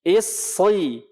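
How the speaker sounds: "Isri" with a careful heavy s; careful model of "Isri" with thickened s; thick emphatic sad. Shown as a demonstration. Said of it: "Hati-hati nih".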